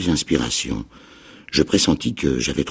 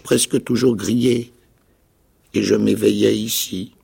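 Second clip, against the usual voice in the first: high, loud cracked castaway voice; a wild islander, half mad and eager; odd castaway; 10 semitones higher, 3 dB louder than usual. A narrator's reading. Marooned man tells the boy his fate, high and loud. Ben Gunn voice.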